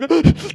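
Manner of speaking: hammed-up sob intake; imitated sobbing gasp. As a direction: gasp like a crying kid catching his breath. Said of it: "trying to speak through the tears".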